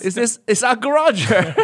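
high mom voice breaking into laughter; mom voice, laughing. Retold or imitated. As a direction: announce it while cracking up. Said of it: "the mom's telling".

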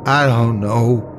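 near tears, helpless; tearful. Acted. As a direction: say it close to crying. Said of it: "Nina was crying now".

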